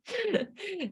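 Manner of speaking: a quick genuine giggle; real laugh. Real, not acted. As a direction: burst of high laughter. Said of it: "Désolée, je rigole".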